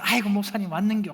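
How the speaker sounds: lightly voiced Gyeongsang dialect, casual and cheerful; mock an overly familiar Gyeongsang greeting to the pastor; a hint of a folksy Gyeongsang parishioner; Gyeongsang congregant greeting. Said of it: "막 웃으면서".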